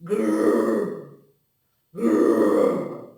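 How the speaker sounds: teacher shows the lion's roar, a breathy loud grrr. Shown as a demonstration. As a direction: growl like a lion. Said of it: "It can roar".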